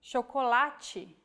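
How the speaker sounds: chocolate with CH as SH, sho-ko-LAH-chee; Portuguese chocolate. Shown as a demonstration. Teacher shows the CH digraph, chocolate with an SH sound. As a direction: soften the CH into SH in chocolate. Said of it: "we have the sound".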